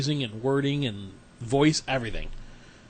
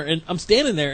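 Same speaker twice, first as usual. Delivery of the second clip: normal talking voice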